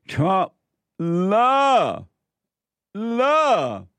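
high, slow, hammed-up drawn-out love; mocking reporter. Mocking a PBS report on Trump, high slow sneer. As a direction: stretch the love sarcastically. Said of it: "Here's another soundbite from P B S".

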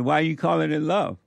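ordinary talk

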